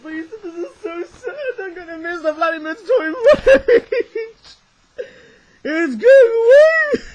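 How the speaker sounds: hammed-up high wail, building to a loud bawl; over-the-top sobbing lament; mock crying. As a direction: bawl it out slowly in exaggerated grief. Mock grief at last toy.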